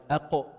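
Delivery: أقو with a bounced qaf; qalqalah. Shown as a demonstration. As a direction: let the qaf in أقو vibrate slightly. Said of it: "to produce a slight vibration".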